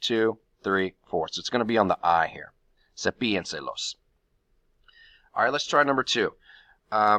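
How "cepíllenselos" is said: "Cepíllenselos" with the accent on "pí", said softly; stress on the I. Shown as a demonstration. Teacher shows students where the accent falls, stress on "pí". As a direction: lean on the "pí" of "Cepíllenselos". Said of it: "count four syllables from the back to find the accent".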